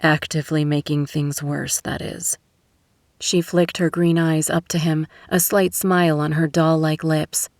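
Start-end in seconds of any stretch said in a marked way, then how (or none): none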